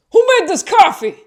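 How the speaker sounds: high, loud imitated yell; angry yell. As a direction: bark the question like a furious customer. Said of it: "he yells in, like, an angry tone".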